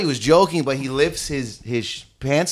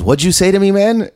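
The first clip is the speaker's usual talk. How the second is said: put-on higher, louder drunk challenge; 7 semitones higher, 5 dB louder than usual. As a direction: call him out like a tipsy bar brawler. Drunk stranger picks fight.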